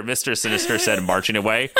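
usual speaking voice

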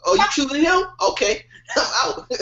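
hammed-up heartbroken disbelief; mock hurt. Acts out jilted man from film.

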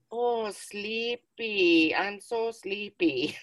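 slow, high drowsy toy voice; mock Furby, slow and high; sleepy Furby voice. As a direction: drag it out like a sleepy robot toy. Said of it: "I heard".

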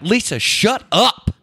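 high, drawn-out mock exasperation; hammed-up irritated "shut up"; retold mad husband. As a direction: act out losing patience with the wife. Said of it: "I'm getting mad".

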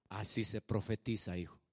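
deep, measured divine reply; voice of God. Acted. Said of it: "la voz de Dios".

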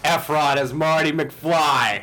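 loud, high, drawn-out proclamation; emphatic announcement. Real, not acted. Fan names his dream casting, loudly proclaimed. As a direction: belt out the casting pick slowly.

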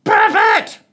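very high, loud parrot shriek; shrieking parrot squawk. A narrator's reading. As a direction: screech the insult like a mad bird. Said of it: "and then squawked".

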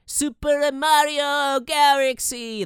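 put-on high, slow little-kid voice; a dad mimicking his son, high and drawn out; imitating his kid. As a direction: mimic a small boy gushing. Imitating his kid's excited talk.

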